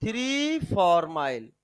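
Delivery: slow dictation. Shown as a demonstration. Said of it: "लिखो बेटा".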